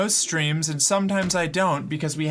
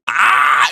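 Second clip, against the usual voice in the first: a loud unvoiced huff of frustration; exasperated loud huff; 11 dB louder than usual. Real, not acted. Streamer to chat about the broken camera, exasperated huff. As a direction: blow out air in exasperation.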